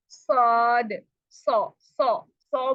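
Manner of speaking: सौद with heavy rounded Arabic ص; सौद said full and heavy from the throat; Arabic saad. Shown as a demonstration. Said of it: "हम ऐसे पढ़ते हैं". (भारी गोल आवाज़ में सौद)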